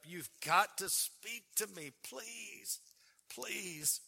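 begging God to speak, voice breaking; desperate pleading prayer. Retold or imitated. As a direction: pitch rising into a begging plea, near tears. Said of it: "I began to sob".

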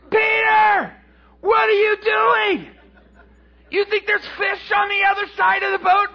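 very high, loud holler; incredulous yelling. Acted. Fishermen on shore to Peter, yelling in disbelief. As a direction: yell across the lake in disbelief.

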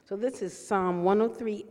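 ordinary talk, nothing marked

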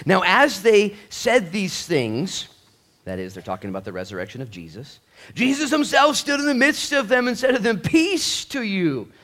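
high, loud preached reading of the verse; scripture reading. Acted. Pastor reading scripture aloud to the congregation, loud and high. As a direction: proclaim the passage to the church.

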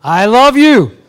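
loud, high I love you; booming pretend declaration. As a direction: proclaim it to your parents. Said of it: "what are you gonna tell 'em".

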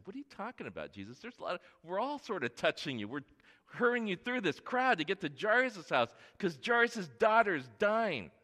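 high, loud and hurried, pushing back; exasperated protest. Acted. Disciples to Jesus in the crowd, exasperated and rushed. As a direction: brush him off, we're in a rush.

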